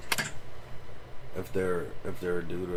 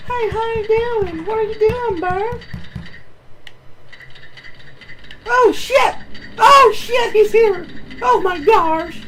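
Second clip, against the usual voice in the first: very high, loud, squeaky cartoon panic; squeaky panicked voice; 4 dB louder, slower than usual. Streamer squeals at a bear in the game, very high and panicked. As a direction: squeal it out in a tiny frantic voice.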